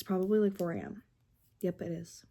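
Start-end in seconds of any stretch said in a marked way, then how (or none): none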